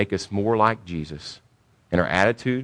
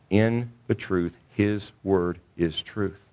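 solemn emphasis; 5 dB quieter, much slower than usual. Real, not acted. Preacher slowing for the scripture's key line.

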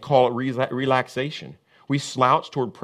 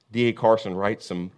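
ordinary delivery

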